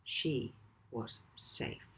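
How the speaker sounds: plain reading voice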